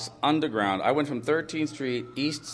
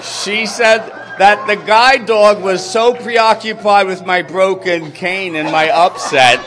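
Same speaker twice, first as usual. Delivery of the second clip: loud, laughing punchline build; 8 semitones higher, 12 dB louder than usual. Real, not acted. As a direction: belt the payoff to the audience. Laughing crowd-pleasing climax.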